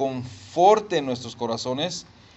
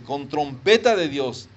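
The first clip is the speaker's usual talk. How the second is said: ordinary talk, nothing marked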